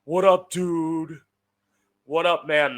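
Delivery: mock bro greeting, loud and slow. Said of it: "Being around bros, being like".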